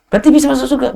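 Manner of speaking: high, eager asking; grandma, thrilled at the news; hopeful excited question. Retold or imitated. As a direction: brighten up with sudden hope. Old woman asks, hopeful.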